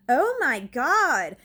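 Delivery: loud, high, drawn-out oh my God; slow, high cry of alarm; retold scared exclamation. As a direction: stretch it out loudly in retold alarm. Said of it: "I am so scared at this point".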